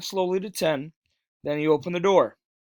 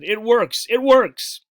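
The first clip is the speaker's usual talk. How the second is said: louder and higher on each repeat; excited shout; 4 semitones higher, 8 dB louder, slower than usual. A narrator's reading. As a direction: shout it out in triumph. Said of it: "he shouted".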